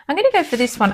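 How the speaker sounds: ordinary delivery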